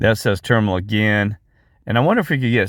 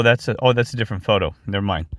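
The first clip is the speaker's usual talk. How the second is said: ordinary talk, nothing marked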